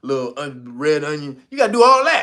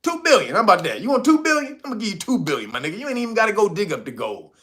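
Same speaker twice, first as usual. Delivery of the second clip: high, wheedling pushy offer; mock America bribing; 9 semitones higher than usual. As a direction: coax them to take the money. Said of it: "what America knows".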